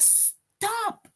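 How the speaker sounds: sudden high sharp stop call; urgent shout. Shown as a demonstration. Teacher showing a child running into the road, sharp high call. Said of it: "somebody is running in the road and you're like".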